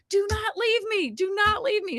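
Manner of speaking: high, pleading cry; desperate plea. Retold or imitated. Mother in labor begging her sister to stay, high and pleading. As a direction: beg her not to go.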